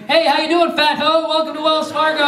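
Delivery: high-pitched hollered welcome; gleeful greeting call. Retold or imitated. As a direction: call out to her across the bank. Gleeful teller calling out her name.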